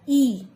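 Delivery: ई said as short 'ih'; short light vowel. Shown as a demonstration. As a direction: sound out ई lightly, without force. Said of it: "हल्का सा ई की आवाज़". (हल्की छोटी ई)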